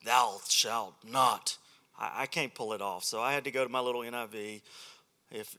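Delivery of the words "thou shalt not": slow, low booming preacher; slow deep King James preacher. Retold or imitated. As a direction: boom it out like an old-time preacher. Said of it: "I don't have that deep preacher voice".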